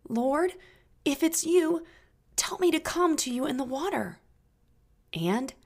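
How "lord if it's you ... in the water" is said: eager plea. Acted. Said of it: "and he says".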